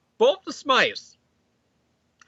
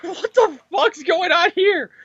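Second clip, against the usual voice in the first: high, slow, incredulous voice; mock shocked outburst; 11 semitones higher, slower than usual. Peter seeing Lizard in the lab, shocked outburst. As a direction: gape at it in horror. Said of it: "he's like".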